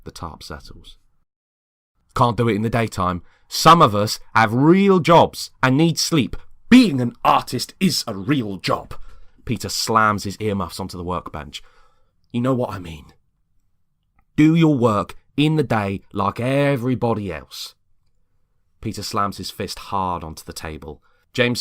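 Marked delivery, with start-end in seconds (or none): 2.1-9.0 loud, high heated retort; heated argument. Acted. James and Peter trading angry lines, loud and high. 12.3-17.7 louder, pressing demand; exasperated scolding. Acted. James scolding Peter with rising loud exasperation.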